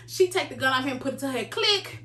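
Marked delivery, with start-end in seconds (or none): none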